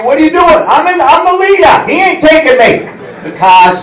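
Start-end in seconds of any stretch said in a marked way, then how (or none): 0.0-0.6 high, alarmed passenger yelling; lightly voiced panicked passenger; mock yell. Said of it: "starts yelling at you". 0.7-2.9 high defiant insist. Retold or imitated. Defiant driver retort.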